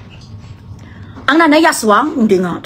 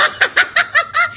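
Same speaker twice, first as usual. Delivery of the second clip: quick high cackle; real amused laughter; 13 semitones higher, 4 dB quieter than usual. Real, not acted. Laughing at viewer reactions.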